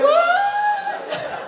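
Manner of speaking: very high, loud, drawn-out "what"; shocked shriek. Retold or imitated. Comic reacting to his buddy's claim, high mock-shocked squeal. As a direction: squeal it in exaggerated disbelief.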